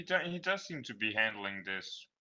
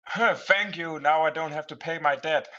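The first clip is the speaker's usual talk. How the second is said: high-pitched psycho quote; psycho raider; 6 semitones higher, 4 dB louder than usual. Acted. Quoting another psycho death line.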